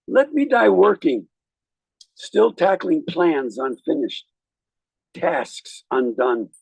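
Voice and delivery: slow, solemn recitation with rising emphasis; poem reading. Acted. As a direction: read the verse like a eulogy. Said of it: "I'll read a little poem".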